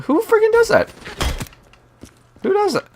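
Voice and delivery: very high falsetto squeal of outrage; squealed disbelief. Real, not acted. Storyteller appalled by a customer's rudeness, squealed disbelief. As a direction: squeak it out, utterly incredulous.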